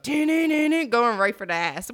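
rising into a shout; building excited yell. Pretend. Hyping the shark attack scene.

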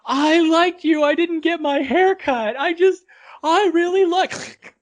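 very high, crying mock lament; hammed-up sobbing protest; tearful wailing. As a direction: wail it like a heartbroken victim, loud and high. Acts out the heartbroken victim.

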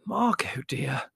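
understated, hushed horror; horrified. A narrator's reading. Mother reproves Margot at the table, horrified. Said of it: "exclaimed Mother, horrified".

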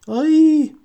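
high, loud squeal; shrill squeal. A narrator's reading. Lavender squeals at the creatures, loud and high. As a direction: squeal in fright. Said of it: "diye ciyakladı Lavender Brown".

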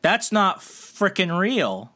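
usual speaking voice

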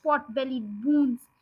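ordinary delivery, nothing marked

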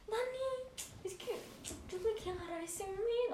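high, soft mock whine; comic tripping-out whine. Telling Dani she wanted that guy, mock pleading whine. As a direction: whimper it high, imitating your drunken self.

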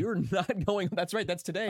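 high, laughing disbelief. Real, not acted. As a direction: laugh through the words. Laughing reply.

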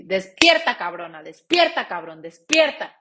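slap yourself awake with it; loud self-rousing cry of despierta; wake-up shout. Shown as a demonstration. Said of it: "date un putazo y di".

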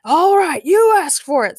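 loud, high battle yell; hammed-up shout, pitched well up; acted scream. A narrator's reading. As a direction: yell it as a warning before attacking. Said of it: "screamed Frankencastle".

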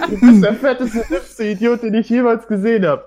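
high, loud laughing tease; laughing insult. Real, not acted. Teasing Blante as a hipster idiot, laughing hard. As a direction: blurt it out while cracking up.

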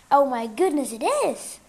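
loud surprised exclamation; startled recognition. A narrator's reading. Aunt suddenly recognizes the guest.

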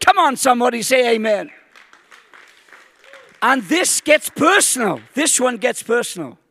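loud, high, ringing pulpit declarations; preaching at full force. Acted. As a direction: proclaim each promise to the whole congregation. Preaching climax, scripture declarations.

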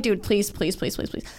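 quiet, rapid string of pleases tailing away; softly imitated begging; retold pleading. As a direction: beg under your breath, running the pleases together. Retelling begging to be let out.

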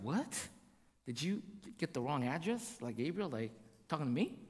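soft, low, puzzled imitation of Mary; mock bewilderment. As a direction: play a quietly baffled girl doubting the greeting. Mary's bewildered reply to angel.

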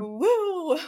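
high, loud mock cheer; excited whoop. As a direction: let out a rowdy whoop. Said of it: "the reaction is, like, this".

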